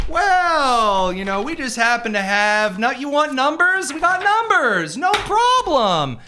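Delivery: very high, drawn-out and loud; exaggerated impression. Host mimicking Luke Rosiak offering numbers, high drawn-out exaggerated impression. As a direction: stretch each word out high, mock-accommodating. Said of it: "He's like".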